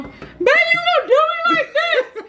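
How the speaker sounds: very high, slow, wailing fake sob; dramatic fake crying. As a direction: beg him tearfully, hammed up. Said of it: "you know I'm dramatic".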